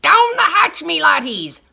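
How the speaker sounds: rowdy captain's toast; pirate yell. A narrator's reading. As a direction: yell it pounding the table.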